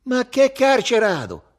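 loud, high, drawn-out scoff; scornful. A narrator's reading. Ugo rejects his friend's song choice, scornful. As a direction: throw it back with loud contempt. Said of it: "fece sprezzante Ugo".

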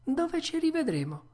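very high, plaintive question; puppet voice. Acted. Puppet asks the tuna about meeting again, high puppet voice. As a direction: pitch it far up without force.

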